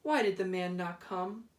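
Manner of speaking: ordinary delivery, nothing marked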